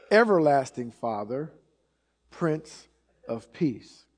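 weighty reading, long pause after each title; deliberate scripture reading. Shown as a demonstration. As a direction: let each name stand alone, unhurried. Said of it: "sort of just skip right through it".

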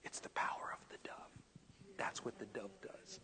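hushed, voiceless whisper; imitated whisper. The Lord whispering in a pastor's ear, hushed whisper. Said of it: "what the Lord whispered in my ear".

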